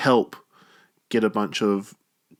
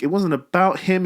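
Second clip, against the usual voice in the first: normal talking voice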